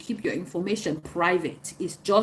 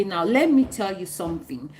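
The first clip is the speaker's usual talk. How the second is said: ordinary delivery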